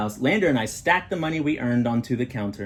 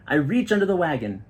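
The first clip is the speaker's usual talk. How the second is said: ordinary delivery, nothing marked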